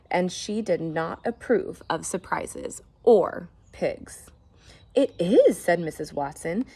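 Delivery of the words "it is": loud, bright confirmation; delighted. Acted. Wife answering the neighbour, thrilled. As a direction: beam it out.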